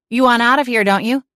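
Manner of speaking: mock menace. A narrator's reading. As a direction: lean on them with playful menace. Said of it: "arching one brow with as much menace as I could muster".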